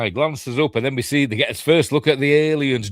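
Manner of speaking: usual speaking voice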